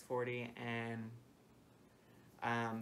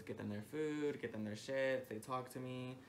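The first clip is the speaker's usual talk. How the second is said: usual speaking voice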